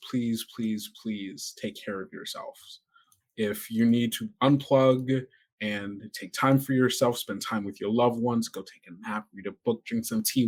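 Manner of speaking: ordinary talk, nothing marked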